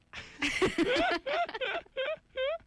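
shrieking giggle at her own ending; high squealing laugh. Real, not acted. Laughs after ending story.

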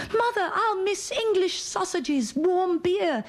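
put-on high, pleading little girl; childish plea. A little girl pleading with her mother about sausages and beer, high and whiny.

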